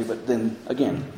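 usual speaking voice

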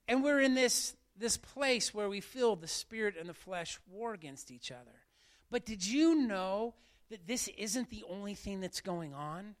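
ordinary talk, nothing marked

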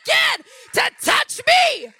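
loud, high mocking taunt; taunting shout. Taunting Satan before going back to sleep, loud mocking. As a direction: gloat over the enemy. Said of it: "I said".

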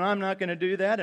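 put-on a foot-stomping five-year-old refusing; lightly voiced pouting child. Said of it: "stomping your feet and wagging your finger".

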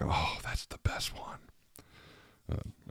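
breathy hushed gush of delight; whisper. Retold or imitated. Host whispers his delight at an accented ID. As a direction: whisper it like a dazzled fan.

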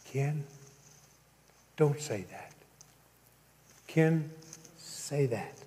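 hushed, spaced-out gentle promptings; soft voice. Retold or imitated. Preacher voicing God's soft promptings to Ken, hushed. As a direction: drop the volume, leave room between phrases. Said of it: "listen to the soft voices".

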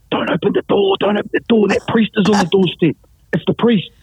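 high, half-voiced urgent warning; mock panic. Gangsters warning each other of the priest at the door, mock panic. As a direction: play up comic alarm in a high, unsteady pitch.

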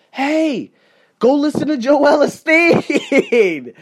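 high, drawn-out mocking jab. Real, not acted. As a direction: stretch it out high and slow, mockingly. Mocking suggestion in rant.

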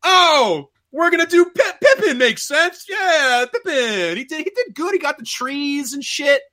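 high-pitched mocking hobbit voice; Pippin. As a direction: squeal it like a silly, overexcited halfling. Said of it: "Pippin is like".

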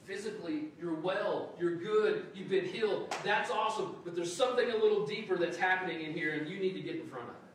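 loud and encouraging, then serious; cheer, then turn earnest; mildly upbeat Jesus. Retold or imitated. Jesus to the healed man, upbeat then earnest. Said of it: "It's like".

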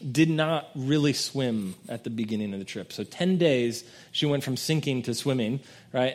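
ordinary delivery

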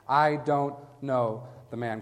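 slow, weighty sworn denial; swearing denial. Acted. Peter swears to the bystanders, slow and emphatic. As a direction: swear it slowly, spacing out each word. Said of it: "began to call down curses".

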